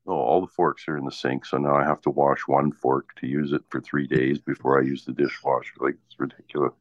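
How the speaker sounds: ordinary talk, nothing marked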